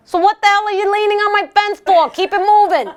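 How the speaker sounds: high, loud, sassy Brooklyn put-down; Brooklyn attitude. Retold or imitated. Telling hipsters off her fence, loud Brooklyn sass. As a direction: shoo the hipsters off with street attitude. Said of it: "that's the B K coming out".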